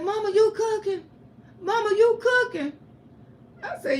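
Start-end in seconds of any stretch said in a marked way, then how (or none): 0.0-2.7 high, astonished double-take; imitating a stunned son, pitched up; amazed son. Son finds his sick mama cooking, voiced high and astonished.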